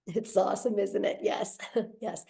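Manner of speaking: normal talking voice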